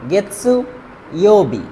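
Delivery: গেতসু ইয়োবি spaced out with a pause after গেতসু; careful pronunciation. Shown as a demonstration. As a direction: model গেতসু then ইয়োবি for students to repeat. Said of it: "এই উচ্চারণটা হলো গেতসু".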